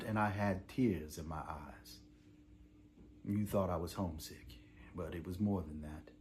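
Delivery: low, soft recollection; subdued. Acted. Prisoner confides childhood tears to parents, low and subdued. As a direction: drop low and quiet on the painful memory.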